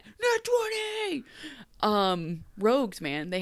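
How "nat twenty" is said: mock nat twenty call. Game master announcing roll.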